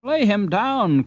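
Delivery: woman in the crowd calls out advice; woman calling out. Acted. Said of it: "called out a woman".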